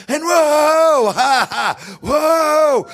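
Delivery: high, loud whoa cries; excited whoops. Real, not acted. Preacher whooping at his congregation, excited and loud. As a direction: burst out whooping with joy.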